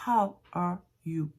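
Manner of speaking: «How are you» slow, each word apart, breathed H; separated words. Shown as a demonstration. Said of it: "On commence par bien séparer".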